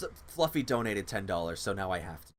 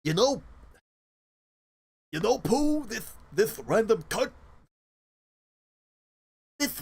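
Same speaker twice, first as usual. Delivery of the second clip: Tigger attempt; 7 semitones higher than usual. Streamer trying Tigger again on stream, higher. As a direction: push for a bouncy Tigger. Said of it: "that doesn't sound like Tigger".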